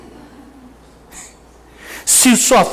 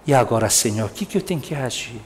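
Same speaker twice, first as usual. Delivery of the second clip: humble prayer; 7 semitones lower, 7 dB quieter, faster than usual. Pretend. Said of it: "Do Espírito".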